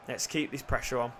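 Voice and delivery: ordinary talk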